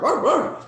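high loud playful roar; mock fox roar. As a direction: roar like a cartoon fox cub. Fox cub barking at dog.

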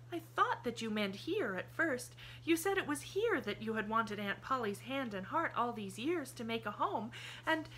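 put-on high child's voice, chatty and sure; a girl happily explaining the plan; cheerful little girl. A narrator's reading. Pollyanna's voice, explaining.

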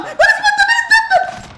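high-pitched frustrated yell, drawn out; excited shout. Real, not acted. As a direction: yell it out in exasperation. Frustrated gamer yelling mid-match. (صرخة منفعلة)

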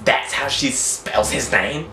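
normal talking voice

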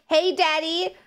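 lightly voiced little kid calling daddy; a hint of a kid saying hey daddy; kid voice from TikTok. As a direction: chirp it like a small child. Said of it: "that TikTok where it's like".

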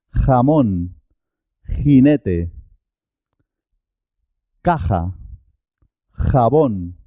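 rasped throat J in "Jamaica, jamón, jinete, caja, jabón"; "J" as KHO-ta, demonstrated word by word; characteristic Spanish J. Shown as a demonstration. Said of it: "pero es muy característico".

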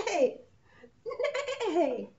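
high, loud laughing neigh; whinny like a horse, giggling. Shown as a demonstration. Teacher showing preschoolers the horse sound, neighing. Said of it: "it makes a sound like this".

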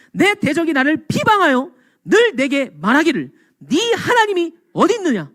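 high, taunting challenge; mocking enemy. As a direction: jeer at a believer with a pointed question. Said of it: "조롱하는 거예요".

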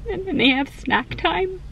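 high mock-tearful squeak; retold tearful. Mom re-enacting reading the school schedule to her husband, mock-tearful. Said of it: "crying and, like, trying to hold back the tears".

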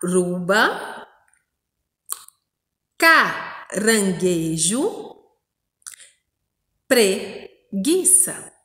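slow word reading, broken after the first syllable; teacher's slow, drawn-out model reading. Shown as a demonstration. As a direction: read each word slowly in chunks, pausing inside it. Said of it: "vamos ler novamente, olha só".

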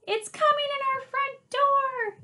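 high, loud read-aloud yell; panicked yell. A narrator's reading. Timmy warning of the monster at the door, yelling. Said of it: "yelled Timmy".

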